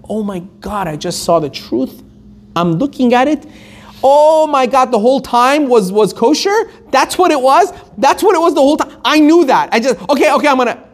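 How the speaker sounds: frantic high-pitched realization, tumbling over the words; rush of regret. Acted. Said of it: "you have this rush of regret".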